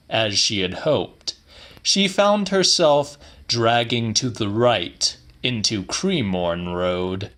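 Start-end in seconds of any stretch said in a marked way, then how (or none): none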